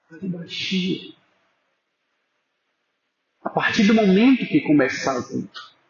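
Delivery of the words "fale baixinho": softly lowered voice; hushed, discreet. Shown as a demonstration. Preacher repeating his advice to the congregation, hushed. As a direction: keep it down, barely disturbing anyone. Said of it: "seja discreto".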